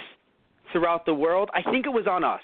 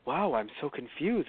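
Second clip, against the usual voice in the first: softer, slow and bewildered; lightly voiced puzzled villain; calm, confused Pegasus; 2 semitones lower, 6 dB quieter than usual. Mocking the movie's Pegasus, soft and slow. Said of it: "here he's just like".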